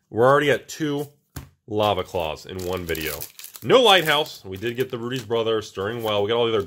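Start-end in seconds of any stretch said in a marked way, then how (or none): none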